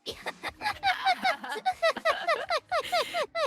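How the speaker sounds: long, high, loud cackling laugh; loud high cackle. Real, not acted.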